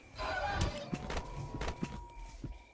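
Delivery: high unvoiced giggle; high laughter. Real, not acted. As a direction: burst out laughing. Laughs after yelling.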